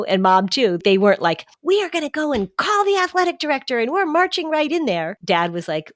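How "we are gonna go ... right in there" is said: high-pitched, hammed-up indignation; mock parental freak-out. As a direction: imitate an outraged parent ready to march in. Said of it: "they didn't freak out".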